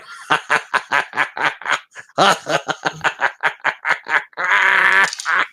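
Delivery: long staccato cackle in character; drawn-out gleeful mock laugh; villainous cackle. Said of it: "you just hear [name] just going".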